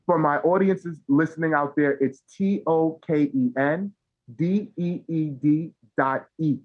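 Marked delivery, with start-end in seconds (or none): 2.3-6.6 letter by letter, unhurried; careful slow spelling for the podcast audience; spelled out slowly. Real, not acted. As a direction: spell each letter clearly for listeners. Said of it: "Spell it out for our audience".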